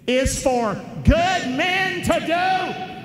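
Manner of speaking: high, slow, loud shout; shouted call. Real, not acted. Preacher leads the congregation in a shouted quote. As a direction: call it out for all to join. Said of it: "say it with me".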